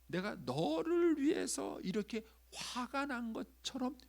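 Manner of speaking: slow, high, mock-angry emphasis; feigned righteous anger. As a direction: stretch it out high, putting on anger. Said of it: "위장을 합니다". (화난 척 높고 느리게 강조)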